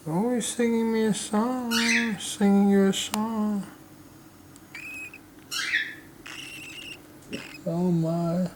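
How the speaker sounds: high singsong coo; baby talk. Acted. Owner cooing at her chirping baby bird, high singsong. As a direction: dote on the chick in a high voice.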